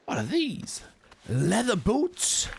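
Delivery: high, drawn-out astonished question; high-pitched Southern-accented amazement; delighted surprise. Real, not acted. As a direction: marvel at an unexpected find. Discovers leather boots in the game.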